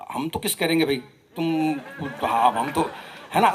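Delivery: normal talking voice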